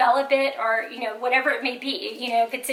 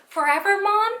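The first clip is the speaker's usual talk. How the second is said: put-on rising, higher child's question; dismayed young son; 7 semitones higher, 3 dB louder than usual. Son asks mom about timeout, mildly dismayed. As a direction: lightly imitate a worried little boy.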